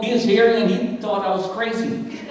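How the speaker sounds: ordinary talk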